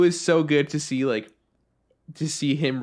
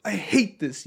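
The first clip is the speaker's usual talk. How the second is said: put-on disgusted kid; 8 semitones higher than usual. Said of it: "he goes".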